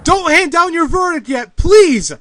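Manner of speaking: loud, high shout to stop the verdict; desperate shout. Acted. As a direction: yell to halt the judge. Lawyer stops the verdict.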